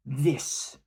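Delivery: boomed. A narrator's reading. Chieftain booms at the prince, loud. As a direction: boom it out like a war cry. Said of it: "Nair your Squotha boomed".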